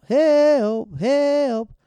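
high, loud, stretched-out holler; retold cry for help. Man knocking from the window, imitated loud cry for help. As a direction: imitate a stranger yelling for help, hammed up. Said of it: "knocking from the window".

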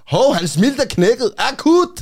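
high, loud, slow imitation of hospital staff; exaggerated urgent announcement, rising to a high shout; mock alarm. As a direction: cry out a sudden emergency, hammed up. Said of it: "Og så laver de".

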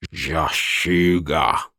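slow guttural rasping voice; deep rasping growl. Acted. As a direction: growl it out slowly. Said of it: "Uma voz gutural".

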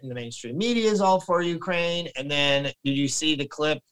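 ordinary talk

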